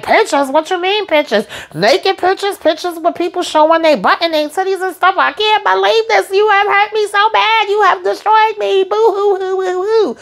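high, whiny, sobbing mock voice, hammed up; mock crying wail. Recapping Joy's meltdown for viewers, high mock sobbing whine. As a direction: ham up a hysterical boo-hoo. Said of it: "But she's like".

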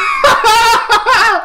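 high, loud sustained wail of fright; shrieking at a sudden threat; panicked shriek. Real, not acted. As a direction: keep screaming in panic. Startled by monster in game.

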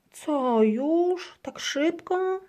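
high, loud disbelief; can't believe it's time; girl surprised it's over. A narrator's reading. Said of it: "dziwi się misia Marysia".

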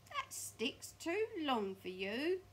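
loud, slow, high drawn-out taunt; Mr Twit taunting. Acted. Mr Twit to his wife about her walking stick, slow loud taunt. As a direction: draw it out loudly at her.

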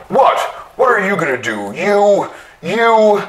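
rising into a high, loud jeering shout; angry taunt. A narrator's reading. Trural kicks the machine and jeers at it, building to a shout.

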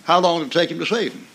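ordinary talk, nothing marked

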